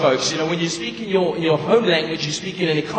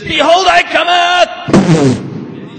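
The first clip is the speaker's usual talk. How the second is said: much higher, louder, slow thundering proclaim; maximum emphasis; 11 semitones higher, 8 dB louder, much slower than usual. Acted. Preacher adding more emphasis, slow thundering high shout. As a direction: thunder every word out slowly. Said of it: "No, more emphasis".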